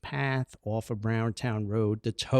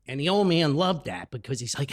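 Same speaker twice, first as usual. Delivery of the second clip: ordinary talk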